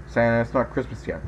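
usual speaking voice

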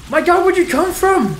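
high, loud cry of shock; startled yell. Real, not acted. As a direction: yell in real fright at the surprise. Enemy appears suddenly.

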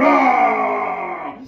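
long, loud mock bear roar; drawn-out roar of a raging bear. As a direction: roar at full voice, hammed-up. Bear smashing the piano.

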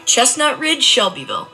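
ordinary talk, nothing marked

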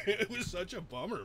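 amused, laughing as you talk. Real, not acted. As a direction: chuckle through the words. Laughing while teasing a friend.

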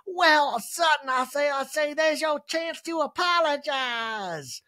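high, slow put-on Sutton voice; imitating Sutton. Kyle imitating Sutton to Dorit, high and slow. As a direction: ham up a grand scolding tone. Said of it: "a good imitation of another housewife".

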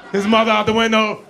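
normal talking voice

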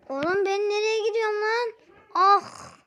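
high, loud startled yell; screaming while falling. Real, not acted. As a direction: shout in panic while flying. Character flung through the air.

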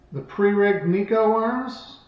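ordinary delivery

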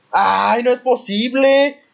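loud, high, slow disbelieving wail; dismayed cry. Real, not acted. Sudden game disaster.

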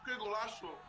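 high, thrilled cry of amazement; excited exclamation. Real, not acted. Reacting to a great goal.

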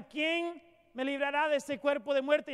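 the verse read again with weight; preaching lament. Acted. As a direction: hang the question over the room. Repeated verse, slower.

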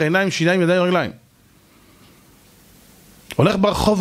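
ordinary delivery

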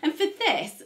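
ordinary delivery

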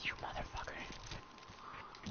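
slow hushed whisper; whispered curse. Real, not acted. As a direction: breathe it out slowly, barely voiced. Quietly cursing teammate.

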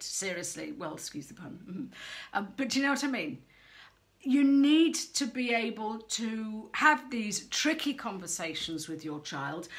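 ordinary talk

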